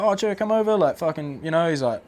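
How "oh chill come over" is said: imitating relaxed Kiwi.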